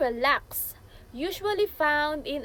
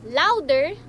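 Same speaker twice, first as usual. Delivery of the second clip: "louder" said louder, as its meaning; "louder" loud and set apart; emphatic key word; 7 dB louder than usual. Shown as a demonstration. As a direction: punch "louder" with extra volume. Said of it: "which part of the word you say".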